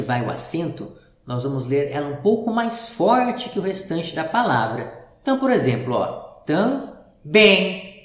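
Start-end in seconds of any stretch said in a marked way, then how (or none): 6.4-7.9 "também" with TAM weak and BEM hit harder and louder; slow syllables, weight on the "bem" of "também"; stress on second syllable. Shown as a demonstration. Teacher demonstrating the accent to pupils, stressed last syllable. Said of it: "o tam o professor leu um pouco mais fraco".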